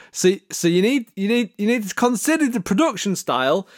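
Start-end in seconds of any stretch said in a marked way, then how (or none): none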